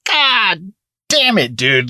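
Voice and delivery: slow high drawn-out curse; dismayed groan. Retold or imitated. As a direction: stretch it out in dismay. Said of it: "I was like".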